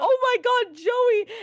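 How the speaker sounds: high mock exclamation; mock alarm. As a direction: act out alarmed disbelief. Retells own alarm at limp Joey.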